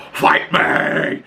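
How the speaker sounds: mock battle yell, strained and drawn out; Coral. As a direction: imitate a furious challenge without full volume. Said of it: "the way she yelled it".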